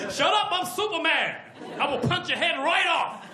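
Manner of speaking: high, loud mock-angry threat; bully him with a threat. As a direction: Superman snapping at Apache Chief, mock angry. Superman snaps at hero.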